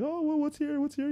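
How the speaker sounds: high-pitched self-imitation; sing-out, high little curious voice; mock innocent curiosity. Acts out innocently checking his phone.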